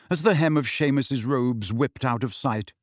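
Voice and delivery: usual speaking voice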